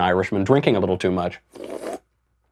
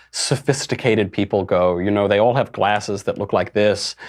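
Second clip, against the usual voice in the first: ordinary talk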